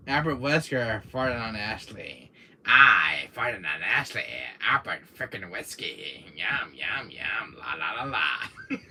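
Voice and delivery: low, silly villain babble; deep mock-villain nonsense; Wesker voice. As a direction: play a low villain being ridiculous. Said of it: "I'm gonna do my Wesker voice".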